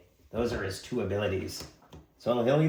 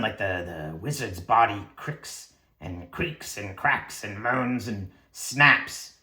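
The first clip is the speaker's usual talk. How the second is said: normal talking voice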